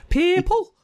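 high, loud imitation Scouse accent; thick Scouse. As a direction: burst out one word in exaggerated Scouse. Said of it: "the thick Scouse accent comes out".

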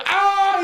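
high, loud mock scream of pain; imitated pain yell. Retelling the coworker's crushed foot, loud high mock scream. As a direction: yelp like your foot just got crushed. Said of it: "He's like yelling".

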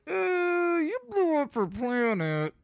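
very high, drawn-out sarcastic voice; high, slow mock retort. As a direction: squeak it out slowly in disbelief. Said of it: "And I was like".

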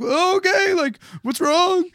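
mock alarm. Startled driver reply.

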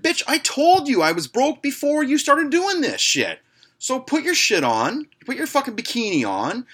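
high, heated retort; indignant telling-off. Retold or imitated. Broke customer refusing to pay the stripper, indignant. Acted quarrel over paying stripper.

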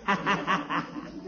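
a warm, rolling laugh; hearty chuckle. Real, not acted. Gildersleeve laughs at Floyd.

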